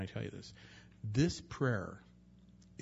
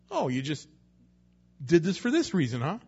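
sarcastic accusation; 3 semitones higher, 6 dB louder than usual. Retold or imitated. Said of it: "my own version of that with God".

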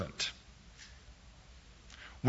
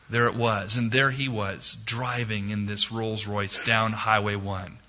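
ordinary talk

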